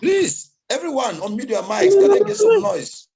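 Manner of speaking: loud and high, rallying the room; calling out. Real, not acted. Host loudly rallying online audience to unmute and cheer. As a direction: pump the crowd up.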